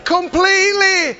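high-pitched, ringing declarations to a congregation; impassioned preaching. Real, not acted. Preacher declaring the dead are free in heaven, impassioned preaching. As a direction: proclaim it in short, rising bursts of triumph.

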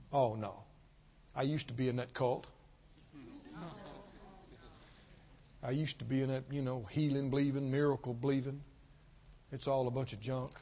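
a hint of a bitter ex-churchgoer brushing it off; dismissive ex-believer. Preacher imitating offended believers who left, lightly scoffing. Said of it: "there's a number of people talk like this".

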